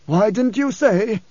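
high, slow, insistent question; demanding blind man. A narrator's reading. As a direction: demand an answer from the guide. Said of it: "the blind man demanded".

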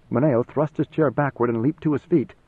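usual speaking voice

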